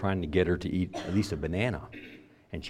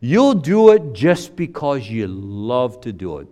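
mocking pious critic; 7 semitones higher, 11 dB louder, slower than usual. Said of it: "People say".

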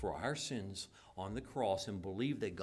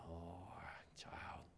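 tender whisper; 7 semitones lower, 15 dB quieter than usual. Retold or imitated.

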